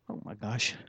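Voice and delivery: usual speaking voice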